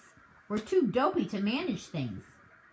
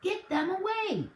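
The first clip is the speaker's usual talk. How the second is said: frantic; 11 semitones higher than usual. Acted. Hazel panicking over car.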